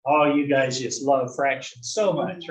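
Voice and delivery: ordinary delivery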